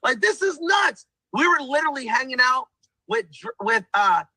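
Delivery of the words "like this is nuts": amazed. Retold or imitated. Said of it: "I'm like".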